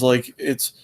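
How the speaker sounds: ordinary talk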